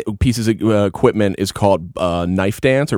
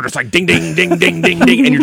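ding sound effect; 7 semitones higher than usual. Retold or imitated. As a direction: ring out each hit like a chime. Imitating repeated crit hits.

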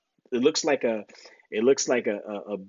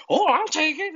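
much higher, eager mock voice; mock eager travel guy. Imitating the travel guy taking the fake deployment, eager and high. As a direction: jump at it like a tourist.

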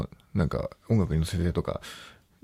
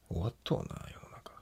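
whispered aside; 10 dB quieter than usual. Real, not acted. Muttering to himself alone.

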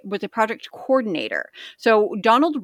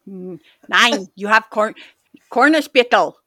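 halting attempt at a German accent; bad German accent; 6 dB louder than usual. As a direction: try "corner spittle" as a stern German word, badly. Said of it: "It sounds like a German word".

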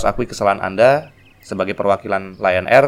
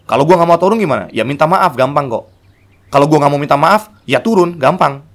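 higher, louder, hammering each choice; higher loud either-or demand; firm ultimatum; 6 semitones higher, 7 dB louder than usual. Retold or imitated. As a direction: lay down the ultimatum. Quarrel retold, firm ultimatum.

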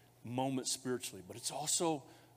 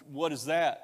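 normal talking voice

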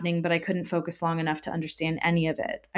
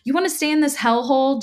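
high, scornful retort spat out; spat back; 8 semitones higher, 3 dB louder, faster than usual. A narrator's reading. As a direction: spit it back in disgust. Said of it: "Jack spat back".

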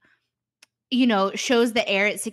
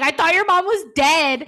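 higher, louder shocked blurt; flabbergasted blurt; 6 semitones higher, 7 dB louder than usual. Retold or imitated. Dune seeing the mom alive and blurting to Mina, flabbergasted loud blurt. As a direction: blurt it out stunned. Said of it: "he is just flabbergasted".

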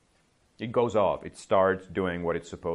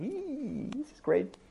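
high, thrilled little squeal; delighted squeal; 5 dB quieter, slower than usual. Retold or imitated. Teacher acts her delight.

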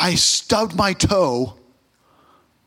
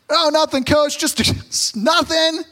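sheepish denial; 9 semitones higher, slower than usual. Retold or imitated. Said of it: "coaches called me over".

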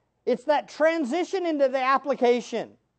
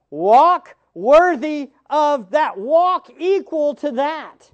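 slow, louder, each word pressed hard; slow loud exhortation; 7 dB louder, slower than usual. Acted. Preacher urging the congregation to walk worthy, slow and forceful. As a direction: drive it home word by word.

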